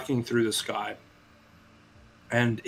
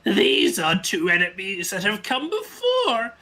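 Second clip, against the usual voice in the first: menacing villain; 7 semitones higher than usual. Acted. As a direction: announce the challengers like a game villain. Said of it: "So he says".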